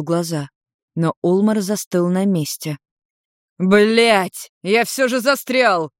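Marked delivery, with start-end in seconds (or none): 3.6-5.9 loud, high, frustrated outburst; slow, aggravated cry; exasperated swearing. A narrator's reading. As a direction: curse at being stuck. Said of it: "выругался дракон".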